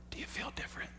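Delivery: breathy whispered question, barely voiced; a soft whispered question to his wife; hushed whisper. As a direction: ask it in a whisper, just for her. Husband quietly asks wife in car.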